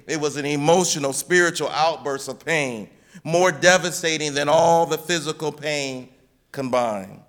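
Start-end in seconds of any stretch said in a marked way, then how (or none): none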